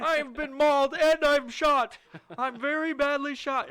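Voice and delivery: high, loud, drawn-out comic wailing; hammed-up wounded victim. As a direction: holler your injuries like a farcical casualty. Acts shot, mauled man.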